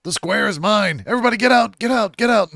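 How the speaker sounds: high, loud mock-crazed yell; hammed-up madman claiming the square; homeless man's rant. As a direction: bellow in a shrieking high voice. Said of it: "He's like".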